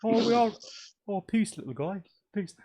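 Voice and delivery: ordinary talk, nothing marked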